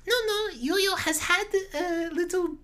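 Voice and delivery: high-pitched, badly faked German accent; terrible German accent. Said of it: "it's a terrible German accent".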